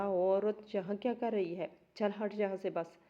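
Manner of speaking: usual speaking voice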